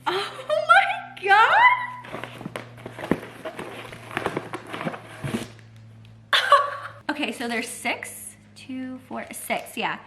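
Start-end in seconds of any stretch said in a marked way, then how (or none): 0.1-1.6 much higher, louder real squeal of joy; excited squeal. Real, not acted. Unboxing a friend's gift, real excited squeal. 1.7-7.0 long high breathless giggle. Real, not acted. Overwhelmed by a friend's gift, high giggling laugh.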